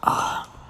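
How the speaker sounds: long breathy sigh; exasperated sigh. Real, not acted. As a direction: let out a weary sigh. Pauses, exasperated, mid commentary on Ken.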